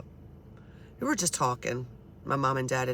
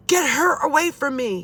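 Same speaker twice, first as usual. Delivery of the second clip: high, loud mock yell of Dad; retold scream, pitched way up; imitated screaming; 9 semitones higher, 5 dB louder than usual. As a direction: cry out as the frightened father. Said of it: "He's screaming".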